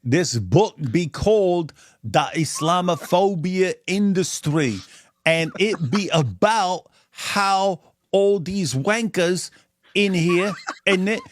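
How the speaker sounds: mock London street accent like Ali G; Ali G impression. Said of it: "the Ali G accent".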